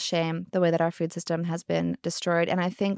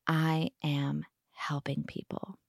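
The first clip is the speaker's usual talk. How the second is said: slow, softer, lower mock-earnest lawmaker; 4 semitones lower, 8 dB quieter, slower than usual. Lawmaker imagined writing farm subsidy laws, slow soft mock earnestness. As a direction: say it slow and self-satisfied, softly. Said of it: "the person who wrote those laws was like".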